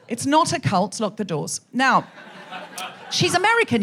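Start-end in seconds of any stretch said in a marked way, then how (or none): 3.1-3.8 imitating mild disdain, pitched higher; mock someone's disdain; higher, mildly scornful mimicry; disdainful. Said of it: "you said that quite disdainfully".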